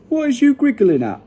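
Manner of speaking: high, mildly irritated question; slightly nettled. A narrator's reading. BFG asks giggling Sophie, slightly nettled. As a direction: ask it high, a touch miffed. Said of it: "slightly nettled".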